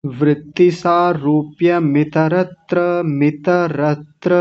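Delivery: slow, drawn-out syllable-by-syllable model; slow model recitation. Shown as a demonstration. Teacher modelling the verse for a student, slowly. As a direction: stretch each syllable for a student to copy.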